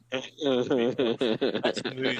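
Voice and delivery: words drawn out through laughter; laughing. Real, not acted. Telling friends about avoiding a row, laughing through the words. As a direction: crack up while saying it. Laughs while quoting himself.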